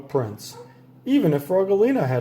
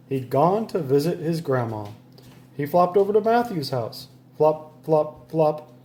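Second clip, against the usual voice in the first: ordinary delivery, nothing marked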